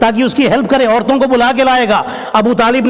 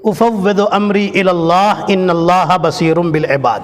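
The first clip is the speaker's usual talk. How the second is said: slow recitation; slower than usual. Shown as a demonstration. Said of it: "ایک آیت پڑھ لیجئے".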